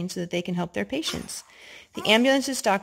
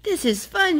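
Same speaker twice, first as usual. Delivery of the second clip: Skunk shouting; 12 semitones higher, 5 dB louder than usual. Acted. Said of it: "shouted Skunk".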